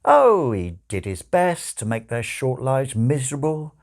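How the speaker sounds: ordinary delivery, nothing marked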